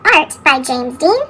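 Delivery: usual speaking voice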